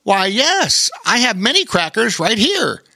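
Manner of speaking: high, put-on storybook character voice; cheerful cracker owner. A narrator's reading. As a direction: answer eagerly in a high character voice. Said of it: "Polly Wanacracker answered".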